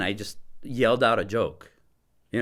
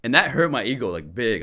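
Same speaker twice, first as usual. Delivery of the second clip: usual speaking voice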